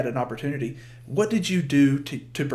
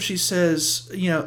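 ordinary talk

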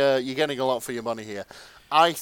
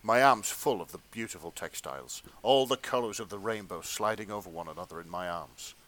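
low, measured story-reading voice; hushed erotica narrator; fanfic narration; 5 semitones lower, 5 dB quieter than usual. Acted. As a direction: read it like a sensual audiobook, played straight.

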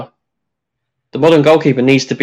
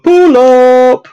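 DJ shout; 11 semitones higher, 6 dB louder than usual. Retold or imitated. DJ calling for a rewind.

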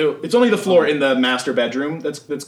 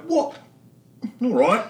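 confused what; 10 semitones higher, slower than usual. Acted. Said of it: "Shah kinda looks at him like".

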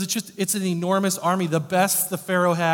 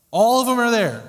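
ALL punched higher and louder; emphatic all; 7 semitones higher, 8 dB louder than usual. Shown as a demonstration. Preacher stressing to the congregation that all came, loud and high. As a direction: hammer home that every last one came.

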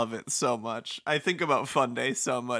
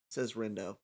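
ordinary talk, nothing marked

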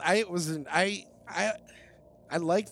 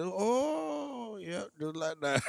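moaning wife; 8 semitones higher, much slower than usual. Retold or imitated. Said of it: "she's like".

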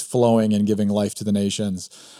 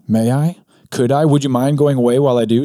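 put-on higher and louder, timid girl; nervous Jill; 4 semitones higher, 5 dB louder than usual. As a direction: ask in a rush, a scared girl. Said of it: "said Jill".

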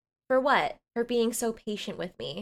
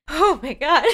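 higher, louder oh my God; flustered; 5 semitones higher, 7 dB louder than usual. Real, not acted. After reading the sex scene aloud, flustered. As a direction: fan yourself in delight.

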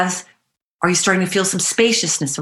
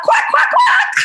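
very high, loud quacking; hammed-up duck noises for the group; duck quacks; 5 dB louder than usual. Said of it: "quack like a duck".

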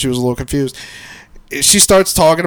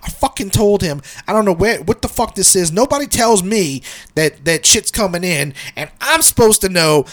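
higher-pitched mock ranting; imitated rant; 7 semitones higher than usual. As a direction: act out a fed-up wife venting. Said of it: "like, ranting".

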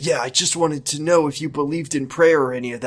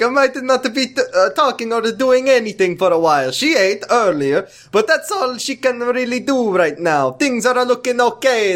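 lightly voiced Italian doctor, higher; a hint of a comic Italian doctor; playing the doctor in a mild Italian accent; 9 semitones higher than usual. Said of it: "in an authentic Italian accent".

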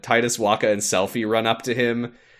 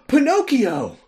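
Pinocchio voice; 4 dB louder, slower than usual. Acted. Voicing Pinocchio parody.

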